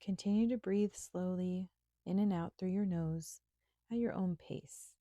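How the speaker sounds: normal talking voice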